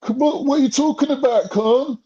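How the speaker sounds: high, whiny mock protest; mock crying protest. Mocking panelists who cry foul at Khan, high and whiny. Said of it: "crying like".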